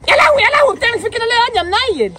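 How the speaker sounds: high shocked mom cry of dismay; shocked shriek. Acted. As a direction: shriek like a scandalized mother. Mother shocked at a kid.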